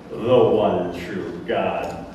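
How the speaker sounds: very slow, low, scornful repeat; mocking echo. Roman governor repeating the priest's phrase, mocking and slow. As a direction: drag it out low in disdain.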